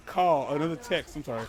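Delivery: ordinary delivery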